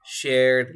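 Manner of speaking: teacher modelling "Shared" with a plain D, no -ed syllable; "Shared" as one beat ending in D; model pronunciation. Shown as a demonstration. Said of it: "¿Cómo sería?".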